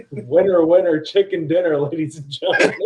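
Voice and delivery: loud, laughing game-show announcement; excited announcer. Acted. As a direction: call it out like a host. Announcing the top rating.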